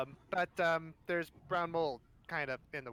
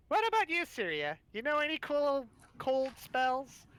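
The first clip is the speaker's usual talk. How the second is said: high character voice; 9 semitones higher, 3 dB louder than usual. Acted. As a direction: redo the line in the character's high voice. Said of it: "hold on".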